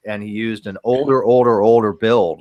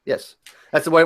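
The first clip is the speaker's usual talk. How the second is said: normal talking voice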